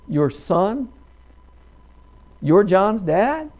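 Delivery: slow, astonished question climbing in pitch; incredulous. Acted. Fraternity boy to the old farmer at the door, incredulous.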